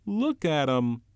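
put-on high, loud alarmed boy's voice. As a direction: point and shout in alarm. Piggy alarmed at the chaos.

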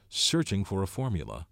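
plain reading voice

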